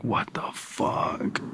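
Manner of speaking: slow, unvoiced whisper of disbelief; whispered what the fuck. Son whispering in the bathroom waiting for piss, stunned. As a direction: hiss it so nobody hears.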